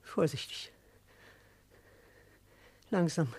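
a hushed whisper to himself; whispered warning in the dark; whispered caution. Acted. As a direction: creep forward, barely voiced. Creeping through the dark.

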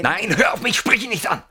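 alarmed plea. Acted. Stops friend from addressing intruder.

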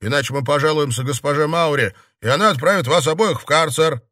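angry shouted ultimatum; barked warning. A narrator's reading. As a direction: order the brawlers to settle down. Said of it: "рявкнул стоящий у двери Юлиус".

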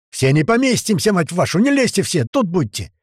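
loud, high, exasperated orders; angry yelling. Acted. Tuman stops men overloading the platform, angry yelling. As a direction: holler at the crowd to stay back. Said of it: "начал орать Туман".

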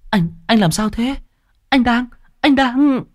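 put-on high, panicked girl's voice calling her brother; a frightened young girl crying out for him. Kiều panicking as her brother collapses, high frightened call.

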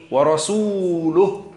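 ورسوله drawn out slowly, final lam sounded with u, wa-rasuluhu; lam with damma. Shown as a demonstration. Teacher stresses the correct vowel for students, slow and drawn. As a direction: stretch ورسوله and land on the u after the lam. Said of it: "اللام مضمومة".

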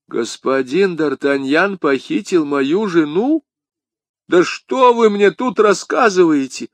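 high, emphatic incredulous questions; indignant disbelief. Acted. Bonacieux cannot believe D'Artagnan took his wife, incredulous. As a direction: climb in pitch with disbelief.

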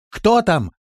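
high, loud call. A narrator's reading. Лирея calling into the dark cellar, shouted. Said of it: "крикнула она в темноту".

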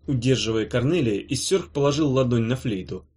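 ordinary delivery

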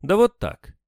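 high, loud, abrupt retort; curt cut-off reply. A narrator's reading. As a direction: cut the subject dead. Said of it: "как отрубила". (резко, как отрубила)

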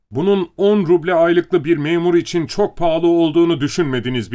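loud, high tirade building to a peak; narrated angry rant, pitched well up; furious shouting. A narrator's reading. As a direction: hurl accusations, climbing to the final yeses. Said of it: "çılgın bir öfkeyle bağırmayı sürdürüyordu".

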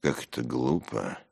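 low-key grumbled complaint; disgruntled muttering; Симонов, grumbling. A narrator's reading. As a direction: grumble it under your breath. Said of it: "проворчал Симонов".

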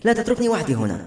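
high-pitched anxious plea; frightened plea. A narrator's reading. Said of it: "كانت تبدو مرتبكة وخائفة للغاية".

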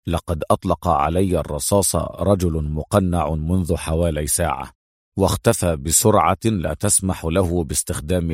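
low, measured briefing; serious. A narrator's reading. Adham assigns Mona to identify the floor's residents, serious. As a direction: lean in and brief her gravely. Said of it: "وقال بجدية".

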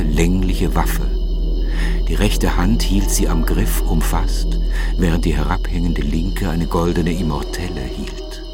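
usual speaking voice

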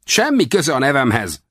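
high, irritated retort; angry flare-up. A narrator's reading. Captive resenting talk of his name, high and angry. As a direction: flare up with high-pitched indignation. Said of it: "fortyant fel dühösen".

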